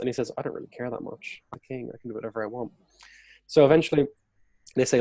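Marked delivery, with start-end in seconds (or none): 0.4-2.7 a lightly voiced king, quiet and offhand; dismissive king. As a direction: toss it away like a careless king, quietly. King's callous remark, mimicked.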